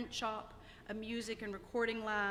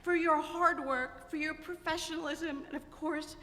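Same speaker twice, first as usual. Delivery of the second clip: higher, louder, voice full of tears; choked up with tears; 6 semitones higher, 8 dB louder than usual. Real, not acted. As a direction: push the thanks out through real tears. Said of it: "I don't know why I'm so emotional".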